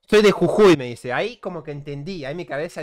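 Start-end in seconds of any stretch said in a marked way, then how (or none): 0.1-0.8 loud, exasperated retort; annoyed shout. Retold or imitated. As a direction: snap back louder. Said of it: "No, pelotudo". (grito indignado)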